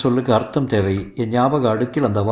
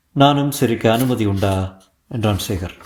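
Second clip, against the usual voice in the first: usual speaking voice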